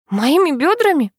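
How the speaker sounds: high, indignant question; flaring up. A narrator's reading. Girl reacting to the driver's remark about her, flaring up. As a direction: flare up in startled offence, pitch jumping. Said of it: "вспыхивает Люся".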